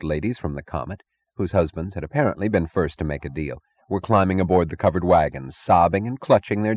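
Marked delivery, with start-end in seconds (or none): none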